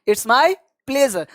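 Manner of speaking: "it's my pleasure" said very slowly, "my" stressed; teacher repeating "it's my pleasure" slowly to be copied; slow model phrase. Shown as a demonstration. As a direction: draw out "it's my pleasure" for learners. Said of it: "तो इस तरह से आपको जवाब देना है".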